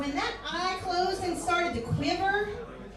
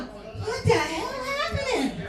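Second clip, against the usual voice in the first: put-on stunned kid; 7 semitones higher, 3 dB louder, slower than usual. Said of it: "I'm this little kid going".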